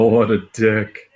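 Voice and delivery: usual speaking voice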